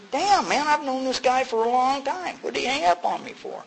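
can't believe he hung up on you; stunned outrage. Retold or imitated. Man hung up on by old friend, high disbelief.

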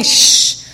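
loud, long shush; retold shushing. Partner hushing her in a meeting, loud imitated shush. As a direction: imitate someone hushing a chatterer. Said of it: "he would tell me".

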